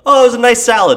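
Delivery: mock-sweet reviewer; sweet gushing voice. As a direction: gush about a nice salad in a high mock voice. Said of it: "you can't just be like".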